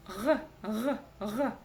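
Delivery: "Г, г, г" as short guttural gh sounds; isolated throaty fricative "г", repeated with pauses; throat-clearing Г. Shown as a demonstration. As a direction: hawk lightly through the "Г" each time. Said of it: "попробуйте отхаркнуться через букву".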